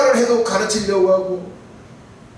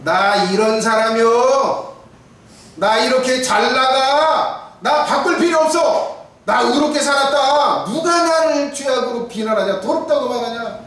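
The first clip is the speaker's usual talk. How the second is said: higher, louder boastful swagger; arrogant man; 5 semitones higher, 4 dB louder than usual. Retold or imitated. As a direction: brag like a proud man who needs no change. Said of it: "이런 사람 어떻게 할까요?". (교만한 사람의 뻐기는 목소리)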